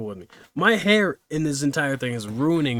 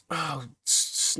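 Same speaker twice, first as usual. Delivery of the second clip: usual speaking voice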